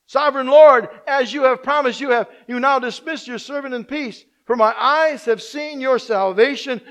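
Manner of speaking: preacher voicing Simeon's prayer, loud and exultant; proclaimed prayer. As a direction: cry the prayer out to the whole church.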